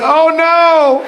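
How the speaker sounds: high, loud mock "oh no"; exaggerated high-pitched dismay. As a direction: wail it like an alarmed girlfriend. Reads the girl's texted reply.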